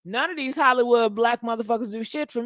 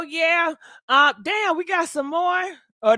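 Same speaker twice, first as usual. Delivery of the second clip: normal talking voice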